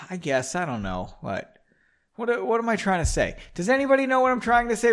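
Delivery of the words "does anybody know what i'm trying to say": quick, high plea to the audience; excited appeal. Real, not acted. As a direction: rattle it off, pitched up. Rhetorical appeal to listeners.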